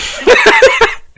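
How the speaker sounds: loud high burst of laughter; real explosive laugh. Real, not acted.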